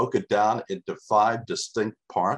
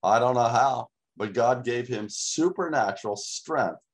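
normal talking voice

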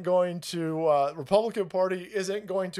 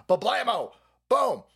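loud, punchy cartoon bang; imitated gun blast; 3 semitones higher, 5 dB louder than usual. Storyteller acting out truck shooting, loud explosive bangs. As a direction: fire off the shots with your voice.

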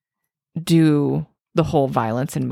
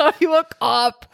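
high movie quote; 9 semitones higher than usual. Retold or imitated. Repeating movie line.

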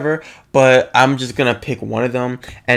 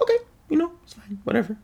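high, soft mock brush-off; airy, quiet retold dismissal; dismissive; 6 dB quieter, much faster than usual. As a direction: lightly imitate shrugging it off, pitched up. Acts out brushing it off.

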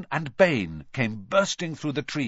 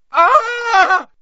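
high piercing shriek of terror; terrible scream; 13 dB louder than usual. A narrator's reading. Malfoy screams at the hooded figure, piercing shriek. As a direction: scream as if something is feeding on a unicorn. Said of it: "let out a terrible scream".

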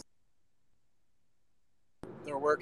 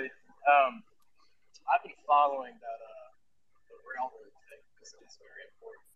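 distant, faint voice off the mic; speaking too far from the microphone. Real, not acted. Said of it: "It sounds like you're far away from your mic".

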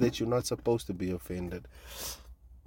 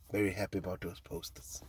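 whispered, soft aside; sly whisper; 5 semitones lower, 11 dB quieter than usual. Real, not acted. Joking about her fans, a whispered aside. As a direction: whisper it like a naughty secret.

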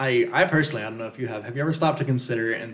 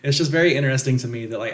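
usual speaking voice

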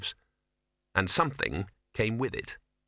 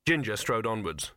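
usual speaking voice

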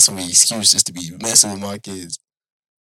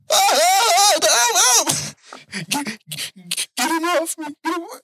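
mock terrified scream. Dad wakes screaming at the elf attacking him, hammed-up.

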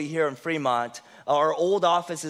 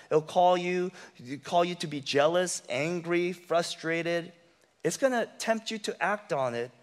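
ordinary delivery

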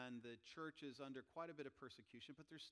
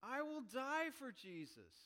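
impassioned Paul; 9 semitones higher, 6 dB louder, slower than usual. Retold or imitated. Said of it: "I'll paraphrase here. He goes".

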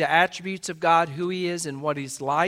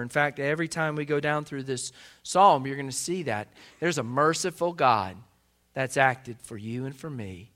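ordinary talk, nothing marked

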